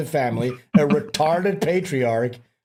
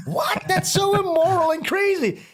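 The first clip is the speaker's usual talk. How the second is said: mock outrage. Mocking the outraged right-wing critics.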